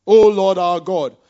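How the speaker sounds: loud, imploring cry to the Lord; calling on God. Acted. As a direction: read the prayer out as a strong, rising plea. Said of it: "Then Asa called to the Lord".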